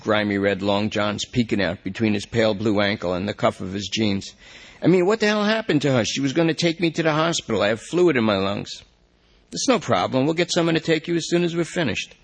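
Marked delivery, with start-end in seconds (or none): 4.8-8.8 high, fast, fretful whine; anxious junkie. Acted. Lester frets about girlfriend. 9.5-12.1 a bit higher, quick placating; calm the suspect down to keep him talking; reassuring detective. Acted.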